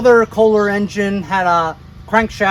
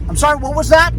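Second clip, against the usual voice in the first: higher, louder imitation Australian accent; down under accent; 8 semitones higher, 5 dB louder, faster than usual. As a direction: imitate a down-under store clerk. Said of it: "in a down under accent".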